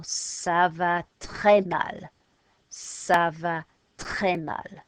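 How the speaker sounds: "très" leaned on, "Ça va très mal" slowed; slow French ça va très mal. Shown as a demonstration. Teacher modelling feeling very bad in French, slow. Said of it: "You go".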